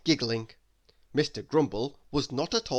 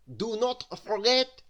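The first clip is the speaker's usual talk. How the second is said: self-important, pompous warning; pompous manager voice; 8 semitones higher, 4 dB louder than usual. A narrator's reading. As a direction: puff yourself up like a proud manager. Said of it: "in a very important voice".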